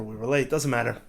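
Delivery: usual speaking voice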